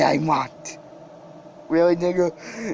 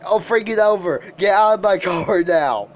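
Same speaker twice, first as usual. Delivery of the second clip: usual speaking voice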